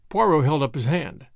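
plain reading voice

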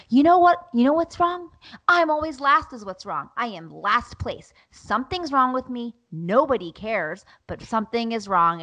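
self-pitying rant, easing down as it goes; retold exasperated outburst. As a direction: re-enact a fed-up mom snapping. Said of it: "And I was like".

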